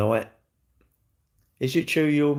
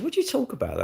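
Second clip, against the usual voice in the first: mock high question; 12 semitones higher, 4 dB quieter, faster than usual. Someone's question, retold in a put-on voice.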